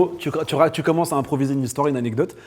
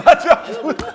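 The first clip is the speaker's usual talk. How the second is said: laughing; 11 semitones higher, 5 dB louder than usual. Real, not acted. Laughing after the reveal.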